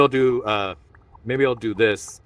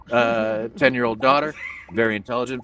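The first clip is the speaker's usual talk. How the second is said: ordinary delivery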